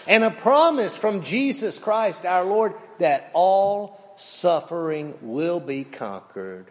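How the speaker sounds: ordinary delivery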